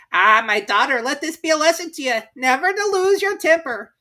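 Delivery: high, loud preachy mother; old crab mother. Acted. Crab mother lecturing her daughter, high and loud.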